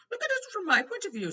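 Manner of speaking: high, loud flustered minister. A narrator's reading. Fudge pleading with Dumbledore, high and loud. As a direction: plead like a harried official. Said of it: "said Fudge".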